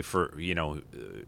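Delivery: ordinary delivery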